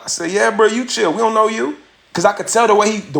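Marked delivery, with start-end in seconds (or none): 0.3-1.8 higher-pitched retold clapback; defiant comeback. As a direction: fire it back at the stranger. Firing back at the stranger.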